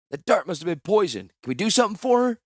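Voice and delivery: high, loud, desperate plea; urgent plea. Acted. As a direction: beg for help, voice straining high. Sailor pleads over dying shipmate.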